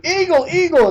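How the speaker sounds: high calling-out of eagle; high, called-out eagle warning; NPC warning call. Retold or imitated. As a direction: shout it as a game NPC warning. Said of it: "the N P Cs out in the world say".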